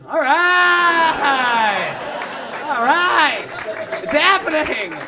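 thrilled the drama is finally here; excited cheer. Retold or imitated. Guests thrilled an objection finally happens, loud excited cheer. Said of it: "But also".

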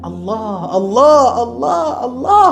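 slow plaintive call, climbing high; demonstrated crying invocation. Shown as a demonstration. Preacher showing how to call on God alone, rising plaintive cry. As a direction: act out a pleading cry, rising with each repeat. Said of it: "പൊട്ടിക്കരഞ്ഞിട്ട് അവനെ ഒന്ന് വിളിക്ക്".

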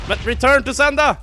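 loud, high gleeful shout; triumphant yell. Real, not acted. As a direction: fire it back with glee. Shooting back at skeleton. (jublande rop)

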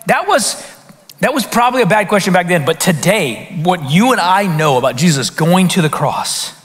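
ordinary delivery

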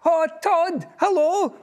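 high, bright squeak of a greeting; a tiny mouse waving hello; cheeky mouse greeting. A narrator's reading. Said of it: "mouse greets the fox".

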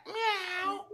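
a small kitten's mew; cat meow. Acted. Teacher to kids, a soft high kitten meow. Animal sounds in picture book.

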